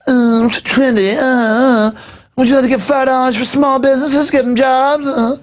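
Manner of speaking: high-pitched put-on trendy voice, long uhs; hammed-up parody of a trendy barista; mock trendy. Host doing one for people, trendy begging donations, high mocking voice. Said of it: "Sure, I'll do one for people".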